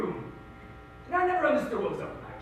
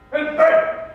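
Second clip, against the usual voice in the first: sudden shout; 12 dB louder than usual. Pretend. Sudden death punchline.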